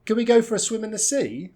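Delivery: high, loud tune over "Can we go for a swim in the sea"; lifted, lively asking tune; question intonation. Shown as a demonstration. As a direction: run it together high, dip on SEA. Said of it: "want some intonation for that question".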